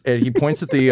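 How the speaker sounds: normal talking voice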